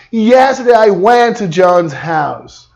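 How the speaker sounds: slow, deliberate English; slow correct version. Shown as a demonstration. Teacher giving the right form after the wrong one. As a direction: spell the correct sentence out slowly. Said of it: "Então você precisa falar".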